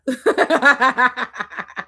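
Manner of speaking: short bright burst of laughter; laughing. Real, not acted. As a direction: laugh with delight at the discovery. Laughs at seeing Medusa.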